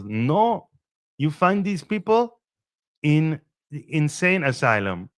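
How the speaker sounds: a quick sketch of an Eastern European guy; imitated Eastern European accent. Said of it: "Eastern European accent".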